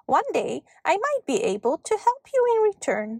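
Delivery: high, quick elfin voice; pleased little elf. A narrator's reading. Elf promising to repay a kind girl, pleased.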